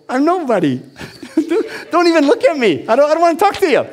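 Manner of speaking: high, laughing, panicky brush-off; nervous high laughing. Retold or imitated. Shy man to a stranger, high nervous laughing. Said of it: "that's how intimidated I was of people".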